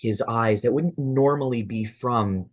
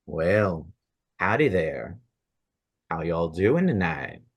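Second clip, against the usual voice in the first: lightly voiced Southern gunslinger; a hint of a low cowboy accent; 4 semitones lower than usual. Gunslinger greeting the party, light cowboy accent.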